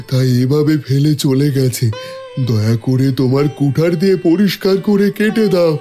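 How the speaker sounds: pleading voice of a wounded tree; talking tree. Acted. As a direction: ask for mercy as an old tree. Tree begs the woodcutter.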